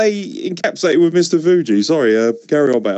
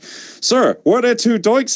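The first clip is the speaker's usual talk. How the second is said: mock interviewer; 8 semitones higher, much slower than usual. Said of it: "and he's like".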